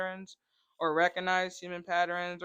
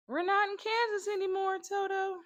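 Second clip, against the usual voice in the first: put-on much higher, girlish quote voice; Dorothy voice; 11 semitones higher than usual. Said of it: "Wizard of Oz quote".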